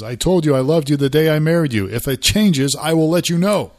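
mock-shocked husband setting his wife straight, loudly; Archie Bunker. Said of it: "he looks shocked and surprised".

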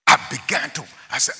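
ordinary delivery

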